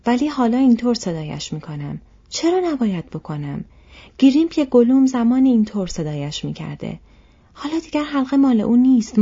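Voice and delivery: heated, defiant retort; defiant, possessive. Acted. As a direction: insist stubbornly, refusing to give it up. Bilbo defiantly refuses to give ring up.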